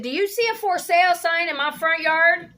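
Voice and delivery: high, loud furious yell; retold shouting at the nosy neighbor; angry shout. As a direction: shout her down in outrage.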